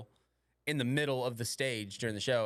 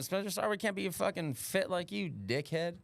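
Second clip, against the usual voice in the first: mock indignant; 8 semitones higher than usual. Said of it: "I was like".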